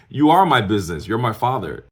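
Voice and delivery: loud, high, indignant comeback; indignant retort. Retold or imitated. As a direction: act out telling your father off. Indignant retort to father retold.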